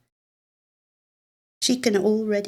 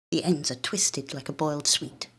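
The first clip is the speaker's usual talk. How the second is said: ordinary delivery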